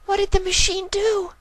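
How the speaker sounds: very high filly voice, nervous; scared filly. Acted. Filly asking about the machine, very high and worried. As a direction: ask in a small worried voice.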